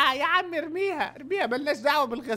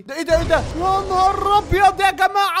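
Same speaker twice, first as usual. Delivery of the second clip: amazed shout; 6 semitones higher, 7 dB louder than usual. Real, not acted. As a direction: shout in disbelief at what you see. Amazed at laser power.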